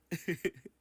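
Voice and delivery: short, loud, high chuckle burst; high laugh. Real, not acted. Laughing fit goes on.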